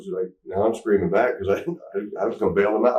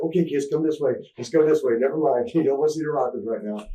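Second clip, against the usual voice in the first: high, mock alarmed teacher voice; a lightly voiced teacher caught off guard; flustered teacher; 7 semitones higher than usual. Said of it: "the lady's like".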